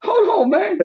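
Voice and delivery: very high, slow squeaky "hold on"; squeaky mock plea. Imitating his friend begging for a pause, high squeak. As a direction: mimic a panicked friend in a squeak. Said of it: "You said".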